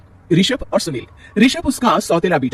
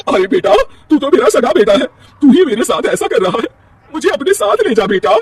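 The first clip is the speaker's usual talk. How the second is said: tearful plea; 9 semitones higher, 7 dB louder than usual. Acted. Mother pleads with son.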